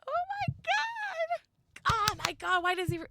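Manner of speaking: very high, drawn-out scared shout; frightened gasp and shout. Real, not acted. Player seeing the dark shape, terrified. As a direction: gasp and yell in real fright. Monster appears before her.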